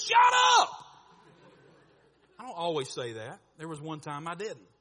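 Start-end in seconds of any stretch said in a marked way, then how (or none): 0.0-0.7 mock snap. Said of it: "sometimes I'll say something like this".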